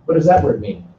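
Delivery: usual speaking voice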